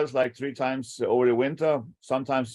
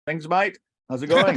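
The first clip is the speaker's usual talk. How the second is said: lightly put-on Aussie accent, pitched up; Australian accent; 3 semitones higher, 5 dB louder than usual. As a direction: greet them in your best mock Australian accent. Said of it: "in the best Australian accent".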